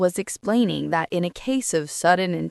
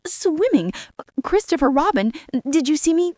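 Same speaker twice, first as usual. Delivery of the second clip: much higher, eager little kangaroo boasting; excited Roo; 8 semitones higher than usual. A narrator's reading. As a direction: go round everyone, proud and breathless.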